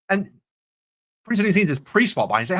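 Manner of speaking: ordinary talk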